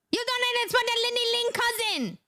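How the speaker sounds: high-pitched incredulous cry; mock outrage. Ribbing the donor over the cousin, high mock outrage. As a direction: squeal it in one high, held tone.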